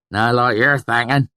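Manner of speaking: put-on high, loud old country voice; rustic swineherd. A narrator's reading. Swineherd warning the narrator, high and loud. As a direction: play the swineherd warning a stranger.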